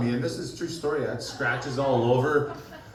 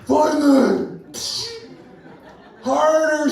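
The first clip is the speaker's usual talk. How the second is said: higher, louder hammed-up demand; mock demand; 11 semitones higher, 6 dB louder, much slower than usual. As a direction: bark it like a bossy lover. Girlfriend demanding in story.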